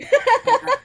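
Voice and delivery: loud high laughing burst; amused outburst. Real, not acted. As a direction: breaks into a laugh.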